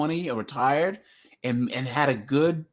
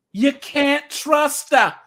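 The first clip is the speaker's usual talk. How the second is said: high, slow, loud mock Jersey accent; 9 semitones higher, 6 dB louder, slower than usual. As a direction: exaggerate a whiny-woman Jersey accent. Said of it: "And then that Jersey accent".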